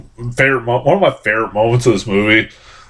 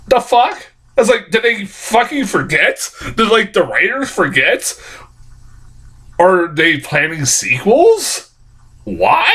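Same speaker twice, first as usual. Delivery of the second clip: incredulous; 7 semitones higher than usual. Retold or imitated. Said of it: "I went".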